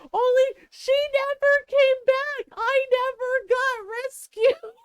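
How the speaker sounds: high, loud mock wail; hammed-up child's tearful complaint; crying. Abandoned little sister telling mom, wailing in tears. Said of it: "starts crying more".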